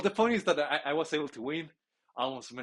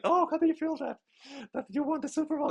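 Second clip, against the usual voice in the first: much higher, louder bubbly reporter voice; mock female reporter; 4 dB louder than usual. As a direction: chirp it like a TV sports reporter. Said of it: "and she's like".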